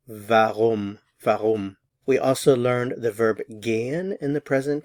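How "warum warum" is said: warum with a V start, varoom; German warum. Shown as a demonstration. Teacher repeats warum for learners, V at the start. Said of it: "notice the pronunciation of the W".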